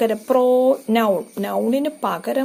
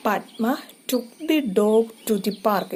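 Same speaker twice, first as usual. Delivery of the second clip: slow example read; much slower than usual. Shown as a demonstration. Teacher reading an example to students, slow and clear. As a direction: read the sentence slowly and clearly.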